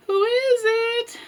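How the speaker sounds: high, thin, drawn-out singsong; grandma calling out. Acted. Grandma answering a knock at the door, calling out. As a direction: call softly in a high, slow grandma voice.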